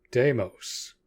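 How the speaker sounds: loud, commanding shout of a name; sharp call. Acted. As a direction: snap his name out to stop him. Sharply calling out to brother.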